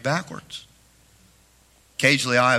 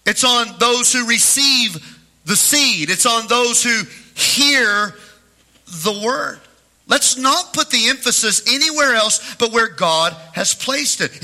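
higher, insistent preacher's delivery; preaching; 8 semitones higher, 3 dB louder, slower than usual. Acted. Pastor driving home the point to listeners, preaching.

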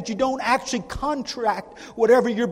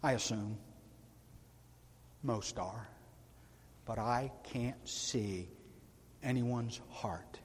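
hushed; 12 semitones lower, 18 dB quieter, slower than usual. Real, not acted. Hushed confiding aside.

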